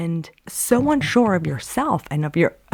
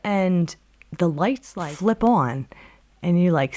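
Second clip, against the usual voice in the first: usual speaking voice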